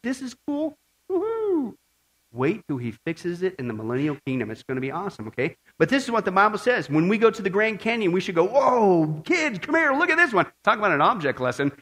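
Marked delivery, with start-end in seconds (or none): 1.1-1.8 mock woo-hoo. Preacher mocking delight at present scenery, high mock cheer. 8.4-10.5 high, excited parent calling out. Acted. Parent calling kids at Grand Canyon, excited.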